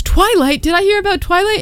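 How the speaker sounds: high-pitched, excited mock question; imitated eager fan. Bookstore worker overhearing Twilight talk, high eager imitation. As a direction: pipe up high like an overexcited fan.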